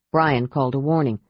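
ordinary delivery, nothing marked

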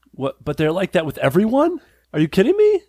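disbelief. Retold or imitated. Mock disbelief, dating comparison.

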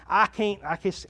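ordinary talk, nothing marked

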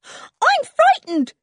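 very high, drawn-out, loud squeal; scared child's high cry; frightened squeal. A narrator's reading. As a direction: squeal it in sudden fear. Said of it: "squealed Jan suddenly".

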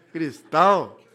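loud, scornful cristal; a loud mocking outburst; mock exasperated cristal. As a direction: throw the word back at her in disbelief. Mocking her word, louder.

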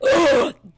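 loud, shocked mock gasp. People hearing she is oldest of nine, loud shocked gasp. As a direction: gasp like your jaw dropped. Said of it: "everyone's jaws drop like".

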